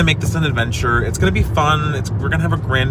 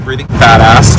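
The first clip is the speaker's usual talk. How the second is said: loud; 13 dB louder than usual. Real, not acted. Boastful joke.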